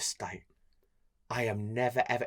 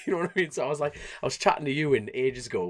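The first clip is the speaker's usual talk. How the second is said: ordinary delivery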